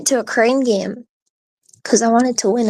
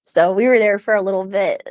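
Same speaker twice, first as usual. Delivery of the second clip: ordinary talk, nothing marked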